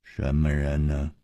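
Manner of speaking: low, cold mocking question; low sneer. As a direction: sneer it darkly. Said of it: "带着哭脸的人说".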